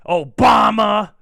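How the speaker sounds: loud, slow, drawn-out bellow; mock blaming shout. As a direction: bellow the name like a curse, stretching it out. Mock blame shout, savouring the name.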